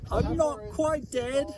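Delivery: high, slow put-on voice; drawn-out, high-pitched "not dead yet" plea; mock dying character. Traveler joking over fallen comrades, high put-on voice. Quotes a film line in put-on voice.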